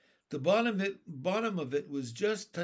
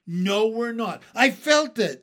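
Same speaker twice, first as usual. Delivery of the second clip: high, very loud insistence; emphatic excited shout; shouted argument; 6 semitones higher, 12 dB louder than usual. A narrator's reading. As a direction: argue back at full voice.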